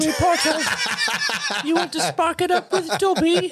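high squeaky elf, half laughing; Dobby impression. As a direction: squeak an invitation through giggles. Dobby bit returns.

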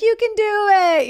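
high, loud encouraging cheer; rally her like a cheering crowd. Retold or imitated. Audience cheering the panicked woman on, high loud cheer.

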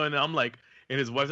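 ordinary talk, nothing marked

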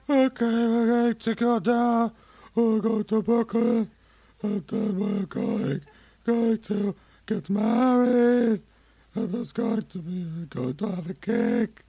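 high, slow, badly faked old Italian lady; a failed high slow old Italian grandma impression. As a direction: squeak out a bad old Italian lady, slowly. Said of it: "this is my impression".

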